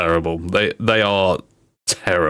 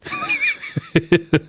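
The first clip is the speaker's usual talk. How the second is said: very high squeal of laughter. Real, not acted. Streamer cracking up at roadman pun, squealing laugh. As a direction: burst into high-pitched laughing.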